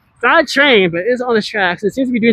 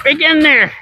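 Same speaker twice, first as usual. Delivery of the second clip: high excited call; 6 semitones higher than usual. Real, not acted. Calling out while riding.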